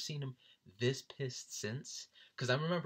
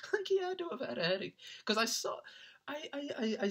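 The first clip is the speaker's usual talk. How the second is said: laughing. Real, not acted. Still laughing over friend's anger.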